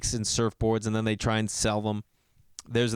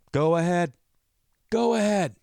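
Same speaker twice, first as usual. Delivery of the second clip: daring; 5 semitones higher than usual. Real, not acted. Daring tough guys to go outside.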